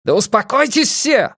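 high, forceful yell; exasperated cry for order. A narrator's reading. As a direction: shout everyone down. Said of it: "заорал Эрик".

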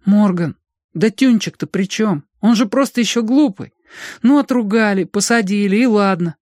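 high-pitched urgent plea; pleading boy. A narrator's reading. Boy begs the leader to spare Tyunchik, pleading.

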